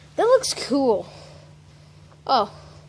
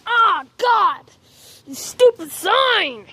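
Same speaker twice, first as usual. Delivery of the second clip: angry outburst; 6 semitones higher, much slower than usual. Real, not acted. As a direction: snap in real pain and irritation. Hit his head on a sign.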